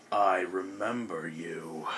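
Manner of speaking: low, slow monster voice, held back; slow, deep creature menace; Ogremon. Acted. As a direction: draw it out low and soft, like an ogre. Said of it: "Ogremon is like".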